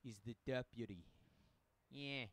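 lightly put-on Italian mobster accent. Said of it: "I went Italian mobster for that".

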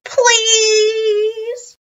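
high, loud, stretched-out begging; shouted plea. A narrator's reading. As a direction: beg like an eager kid. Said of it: "I shouted".

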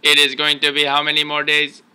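monotone robot, every word on one pitch. Pretend. Parent teasing child about age, monotone robot voice. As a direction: keep it mechanical, no inflection. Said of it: "talking like a robot".

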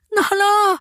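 high, drawn-out scream of the name; a frightened girl crying out to her father; long cry for help. Acted. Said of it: "अशी दीर्घ आरोळी बाहेर पडली".

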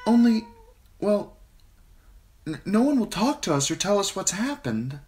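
put-on high girlish voice, faltering; a girl daring to complain; hesitant young girl. A narrator's reading. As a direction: Sansa timidly asking the queen.

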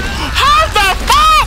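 high, drawn-out shocked shriek; shrieking disbelief. Real, not acted. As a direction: stretch it out in pure shock. Shocked at a game hack.

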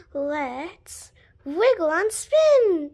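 plain reading voice

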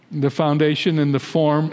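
ordinary talk, nothing marked

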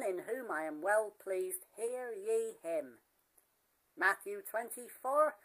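ordinary talk, nothing marked

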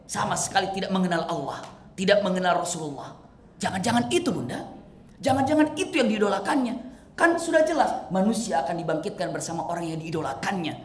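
usual speaking voice

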